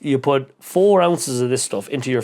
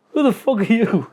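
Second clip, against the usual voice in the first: mock challenge; 10 semitones higher than usual. Retold challenge from a stranger.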